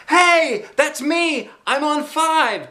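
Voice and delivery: put-on high, loud excited boy's shout. A narrator's reading. Red-haired boy claiming his prize, high excited shout. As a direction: yell it like you just won. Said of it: "shouted a boy with red hair".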